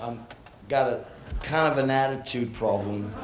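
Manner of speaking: usual speaking voice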